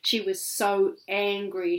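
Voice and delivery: usual speaking voice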